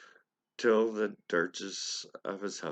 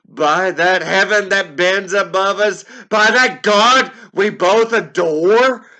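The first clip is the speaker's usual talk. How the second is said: much higher and louder, slowing on the pleas; desperate shouted plea; 10 semitones higher, 14 dB louder than usual. Acted. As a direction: beg the bird at full voice.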